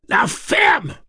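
screamed, very high and loud; yelled. Acted. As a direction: scream it into the dark. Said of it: "hurla Eddie".

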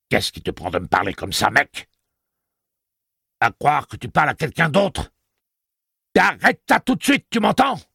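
high, loud furious woman's voice; outraged shriek. A narrator's reading. Detta rejects the name Odetta, shrill and loud. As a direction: screech it, climbing in pitch.

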